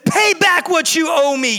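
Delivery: high, forceful demand. A narrator's reading. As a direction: demand payment, pitched well up. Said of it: "he demanded".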